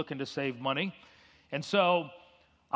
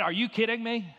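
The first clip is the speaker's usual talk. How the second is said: retold outburst; 6 semitones higher than usual. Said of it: "I lost my sanctification".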